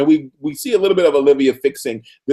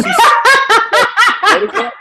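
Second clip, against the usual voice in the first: much higher, loud and slow with a laugh; laughing astonished shriek; amazed squeal; 6 dB louder, much slower than usual. Real, not acted. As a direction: squeal in delighted disbelief. Amazed at Olivia's Russian.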